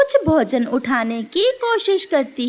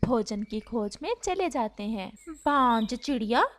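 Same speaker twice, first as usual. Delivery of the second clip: much softer, gentle storytelling voice; soft story narration; 3 semitones lower, 8 dB quieter than usual. A narrator's reading. A narrator softly tells a sad bird story, hushed. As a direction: read it softly like a bedtime tale.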